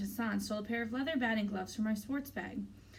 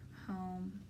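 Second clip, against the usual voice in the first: small, soft reply; quiet, reluctant answer; 2 semitones lower, 8 dB quieter than usual. A narrator's reading. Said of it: "I say softly".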